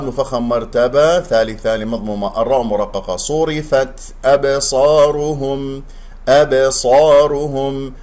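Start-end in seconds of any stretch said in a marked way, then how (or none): 3.2-7.9 slow chanted "أبصارهم" with an echoed b; bounced ba recitation. Shown as a demonstration. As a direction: recite "أبصارهم" drawn out, bouncing b before heavy s. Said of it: "الباء مقلقلة".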